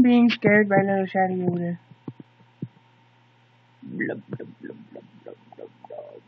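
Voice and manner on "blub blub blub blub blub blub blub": whispered blub-blub fish gurgles; gurgling fish man croak. Acted. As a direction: breathe out soft fish bubble sounds. Fish bubbling noises.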